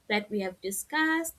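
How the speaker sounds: usual speaking voice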